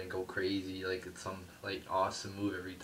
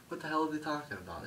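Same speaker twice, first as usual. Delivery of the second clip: incredulous; 8 semitones higher, faster than usual. Real, not acted.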